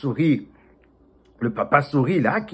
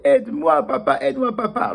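high, pleading call to papa; crying for help; 11 semitones higher, 3 dB louder than usual. Acted. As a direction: keep begging for help. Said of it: "il continuait à crier".

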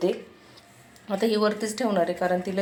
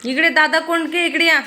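calling out; 8 semitones higher, 5 dB louder than usual. Real, not acted. Calling the child over.

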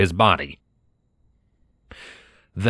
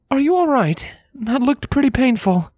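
higher mare voice, gently worried; concerned mare. Acted. Scarlet checking on her hurt friend, concerned. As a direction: ask after him with light concern.

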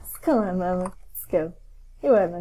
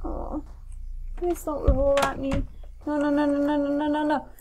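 nervous pleading; 4 semitones higher, 7 dB quieter than usual. Real, not acted. Wheelchair rolling at player.

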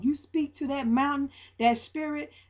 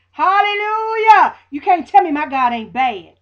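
laughing church shout; joyful hallelujah; 5 semitones higher, 11 dB louder than usual. Real, not acted. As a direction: let it ring out with a laugh. Joyful outburst after the story.

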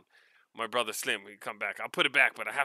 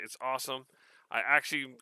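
usual speaking voice

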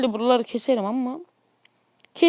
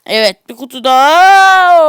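slow, much louder drawn-out shout; 12 dB louder than usual. Real, not acted. Gamer yelling at another loot box, slow and loud. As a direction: belt it out at the box opening. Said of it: "Neden böyle bir ses tonuyla bağırdım".

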